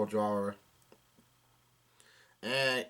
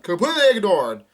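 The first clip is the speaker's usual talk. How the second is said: high, loud cry; loud, raised narrated yell; 7 semitones higher, 10 dB louder than usual. A narrator's reading. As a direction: shout it out as a character's outburst. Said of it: "no, Gambia yells out".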